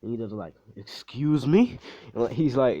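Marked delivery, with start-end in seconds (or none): 0.8-1.8 indignant, drawn-out question rising high; mock-offended, slow and higher. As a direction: stretch it out in loud, affronted disbelief. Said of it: "he's got getting offended".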